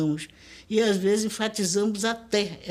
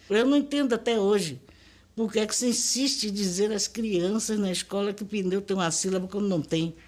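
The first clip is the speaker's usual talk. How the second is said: usual speaking voice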